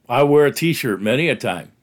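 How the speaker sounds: normal talking voice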